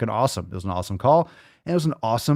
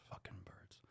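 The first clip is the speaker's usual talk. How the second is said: whispered grumble; 4 semitones lower, 33 dB quieter than usual. Acted. Muttered after clip.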